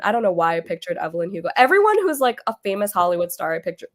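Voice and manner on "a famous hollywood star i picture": ordinary talk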